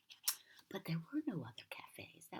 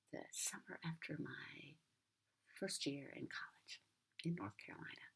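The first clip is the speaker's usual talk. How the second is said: ordinary delivery, nothing marked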